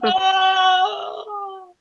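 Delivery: long, high distant wail; mock scream. Game master as villain flying out the window, mock scream. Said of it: "and he screams".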